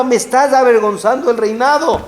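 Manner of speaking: king's scolding. Retold or imitated. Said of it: "El rey le gritaba".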